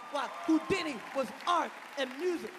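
ordinary delivery

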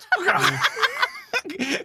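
shrieking laugh. Real, not acted. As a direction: burst out laughing. Big laugh.

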